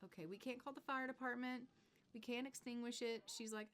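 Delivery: normal talking voice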